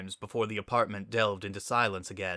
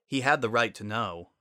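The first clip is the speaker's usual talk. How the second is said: ordinary delivery, nothing marked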